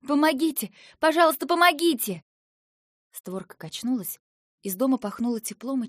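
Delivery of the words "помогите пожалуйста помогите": выше и громче, срываясь на крик; кричать, колотя в дверь; отчаянный крик о помощи. A narrator's reading. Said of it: "заколотила как сумасшедшая". (отчаянно кричит «Помогите!»)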